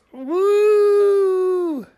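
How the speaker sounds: long high woo; high whoop. Real, not acted. Vlogger putting on eyelashes, high whoop. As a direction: sustain a high-pitched woo.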